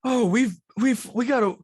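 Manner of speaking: high, loud, stumbling explanation; flustered camp head. Acted. Stanley explains the logs.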